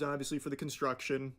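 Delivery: normal talking voice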